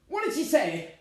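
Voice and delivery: high, loud, sharp question; urgent demand. Acted. Bride quizzing groom after the call, demanding. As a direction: press him for the answer.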